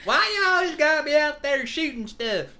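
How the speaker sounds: high-pitched comic nagging; loud mock telling-off; hammed-up scolding mother-in-law. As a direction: holler at the shooter in mock exasperation. Replays the scolding role.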